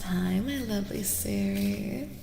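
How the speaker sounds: normal talking voice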